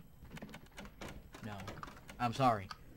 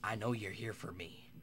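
ordinary delivery

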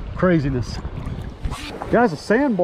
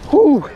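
relieved whew and laugh; 8 semitones higher than usual. Real, not acted. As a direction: blow out relief then giggle. Fish landed, relief.

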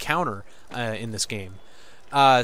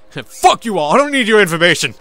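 loud, high dismissive yell; playful loud outburst; mock defiant shout; 6 semitones higher, 9 dB louder than usual. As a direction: shout them off with a laugh behind it. Yelling at the game's informants.

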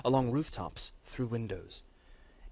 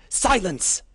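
much higher and much louder commanding shout; 9 semitones higher, 12 dB louder than usual. Acted. Matriarch silencing a pleading battle lord, furious shout. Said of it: "shouted Sora".

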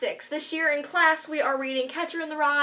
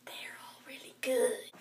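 whispered aside; whispering; 2 semitones lower, 13 dB quieter than usual. Real, not acted. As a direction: drop to a whisper. Aside to the camera.

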